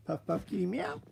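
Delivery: high squeaky meow; soft high meow rising up; playful squeak. Pretend. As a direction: toy with the catchphrase in a squeak. Playing with Tourette's catchphrase.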